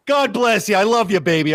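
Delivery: a hint of high prank-caller voice. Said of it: "you sound like [name] from a Jerky Boys call".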